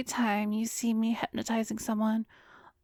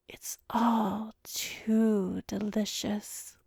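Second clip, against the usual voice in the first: whisper; much slower than usual. Acted. Vampire savoring entranced patient.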